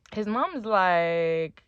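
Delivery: ordinary talk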